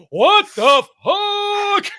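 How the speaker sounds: retelling the moment he saw his broken leg, a slow stunned shout. Retold or imitated. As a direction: yell it slow in total shock. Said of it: "And I said".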